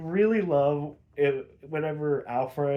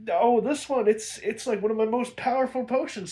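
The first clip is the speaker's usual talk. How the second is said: witch aunt bragging; 8 semitones higher than usual. Retold or imitated. Witch aunt tries her strongest potion, higher and proud. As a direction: puff up a hint of a proud witch.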